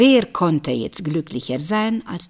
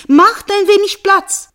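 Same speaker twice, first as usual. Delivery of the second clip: much higher and louder, insisting; calling out; 12 semitones higher, 8 dB louder than usual. Acted. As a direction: demand room for the little pot. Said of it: "rief sie den anderen Töpfen zu". (lauter, hoher Ruf an die Töpfe)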